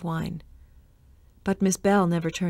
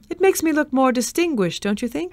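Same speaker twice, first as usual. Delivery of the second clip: lightly voiced vain Jason; 7 semitones higher than usual. A narrator's reading. Jason vain about silver hair.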